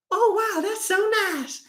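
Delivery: very high, soft delighted buyer; gushing customer. Retold or imitated. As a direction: coo like a thrilled sucker. Customer thrilled by deal.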